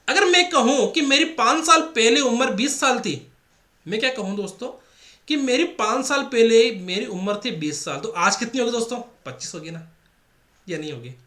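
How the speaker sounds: normal talking voice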